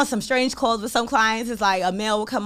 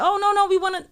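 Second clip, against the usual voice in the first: imitating eager clients; 11 semitones higher, 5 dB louder than usual. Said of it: "They're like".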